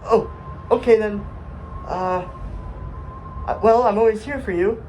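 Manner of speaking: high, meek, halting voice; timid Astrocyte, meekly supportive. Acted. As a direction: back off shyly but stay helpful. Plays the shy Astrocyte.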